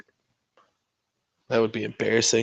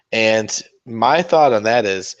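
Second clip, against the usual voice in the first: ordinary talk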